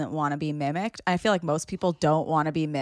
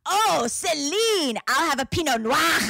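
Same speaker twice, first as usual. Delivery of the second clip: mock French sounding German; 9 semitones higher than usual. Friend [name] mocking Celine, German-sounding French. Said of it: "sounded like German".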